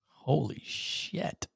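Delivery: slow, voiceless whisper of shock; stunned whisper. Real, not acted. Host whispering after the co-host's angry rant, stunned. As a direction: breathe it out, slowly and amazed.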